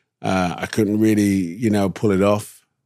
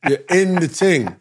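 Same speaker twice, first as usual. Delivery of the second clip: London slang; 8 semitones higher than usual. Shown as a demonstration. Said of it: "start speaking like an American".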